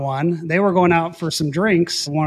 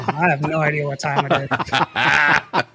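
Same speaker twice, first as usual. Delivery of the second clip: laughing; slower than usual. Retold or imitated. Drunk pilot answering officer, laughing.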